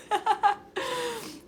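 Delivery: high giggle through happy tears; real laugh while welling up; tearful laughing. Real, not acted. Streamer telling a friend not to worry, tearful laugh.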